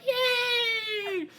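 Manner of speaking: put-on high squealed child's yay; excited yay. A little girl skips off to buy candy, squealing with joy. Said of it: "skips off and is like".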